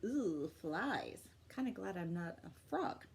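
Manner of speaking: ordinary delivery, nothing marked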